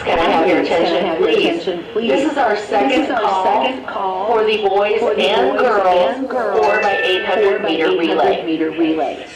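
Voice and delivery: ordinary delivery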